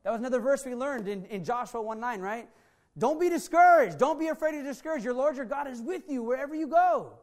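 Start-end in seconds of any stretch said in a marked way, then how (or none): 3.0-7.1 higher and louder, preaching it out; proclaiming scripture. Acted. Pastor proclaiming Joshua to a discouraged congregation, loud and high. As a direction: ring the verse out over the room.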